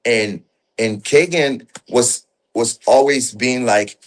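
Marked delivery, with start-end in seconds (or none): none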